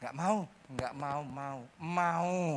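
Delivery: low, slow, softening mock refusal; weakening refusal. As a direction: let the acted resistance melt gradually into giving in. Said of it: "Lama-lama gimana?". (pura-pura menolak, pelan lalu luluh)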